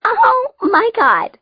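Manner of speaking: very high, loud, drawn-out squeal; excited squeal. A narrator's reading. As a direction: squeal it like an astonished girl. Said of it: "Nudge squealed".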